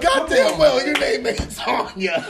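high, slow scolding quote; slow, high "you know that's not your name"; mock disbelief. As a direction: call her bluff in a put-on voice. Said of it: "Me and my ex was like".

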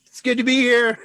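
a real emotional outburst, voice leaping up; overcome with emotion. Real, not acted. To his live viewers, overwhelmed, high and loud. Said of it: "I get emotional".

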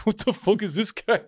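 high-pitched mock disbelief; retold bewilderment. Reacting to a jeering stranger, mock bewilderment.

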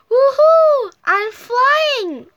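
high, loud whoop of joy; gleeful shout. A narrator's reading. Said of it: "shouted Spotty".

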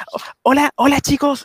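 high sugary influencer greeting; Chris Hemsworth impression. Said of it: "soy Chris Hemsworth".

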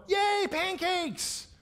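high, drawn-out mock child cheer; gleeful kids' cheer. Boys hearing there will be pancakes, gleeful cheer. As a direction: squeal it slowly like thrilled little boys. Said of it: "What's the response I want?".

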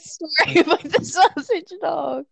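high, drawn-out plea through giggles; playful pleading. Real, not acted. Begging the host for the sausage dog story, playful pleading. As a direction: beg for it, stretching the words out high.

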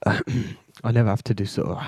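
normal talking voice

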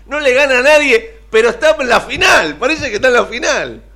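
loud, high-pitched indignant rant; real exasperated outburst. Real, not acted. Sports pundit ranting about Boca reaching the final, exasperated outburst. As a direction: raise your voice in disbelief.

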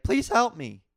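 high, loud mimicked plea; retold scream. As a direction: imitate a desperate shout for help, short of a full scream. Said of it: "She was screaming".

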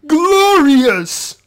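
high, loud, drawn-out villain cry; Shao Kahn. Retold or imitated. Critic mimicking a villain in his lair, high mock triumphant shout. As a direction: belt it out as a ridiculous squawk. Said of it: "in his lair, is going".

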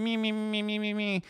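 mock a self-centered whiner; mocking whine. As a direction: parody of a whiny rad lib. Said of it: "constantly going".

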